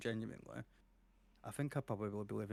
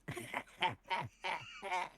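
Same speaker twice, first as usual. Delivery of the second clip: high mocking cackle; lightly imitated mocking laugh; mocking villain laugh; 7 semitones higher, 3 dB quieter than usual. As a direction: cackle at the victim. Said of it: "she laughs at you and she goes".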